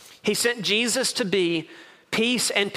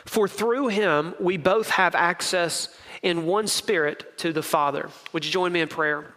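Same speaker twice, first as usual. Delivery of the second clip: normal talking voice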